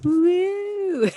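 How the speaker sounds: high, loud celebratory whoop; excited cheer. Real, not acted. Podcast guest cheers as co-host validates her hype, whooping. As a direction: let out a big high woo.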